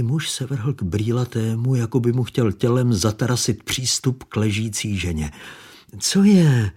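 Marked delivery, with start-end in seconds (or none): none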